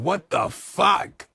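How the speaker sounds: loud, stretched out what the fuck; slow disbelieving shout. Real, not acted. Shouting at Jesse's blunder, slow and drawn out. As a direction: drag it out in outrage.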